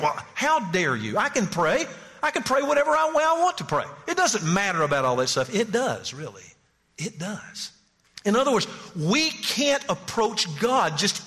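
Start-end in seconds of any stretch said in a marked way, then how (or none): 0.0-5.5 an offended churchgoer bristling; mock indignant. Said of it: "it's almost like".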